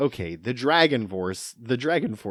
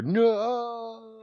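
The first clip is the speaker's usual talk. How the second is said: high mock falling cry; Hans Gruber fall scream; 12 semitones higher than usual. Host imitating villain falling to his death, mock Hans Gruber cry. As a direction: wail it like a villain dropping off a building. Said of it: "a Hans Gruber".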